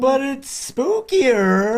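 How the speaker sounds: high, slow ghostly wail; spooky ghost voice. Pretend. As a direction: moan it like a cartoon ghost. Host keeps up ghost voice.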